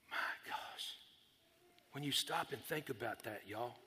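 very soft, near-whispered wonder; quiet, low and moved; hushed awe. Real, not acted. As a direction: drop to a hush, overwhelmed by the thought. Moved by thought of sacrifice.

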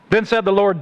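ordinary talk

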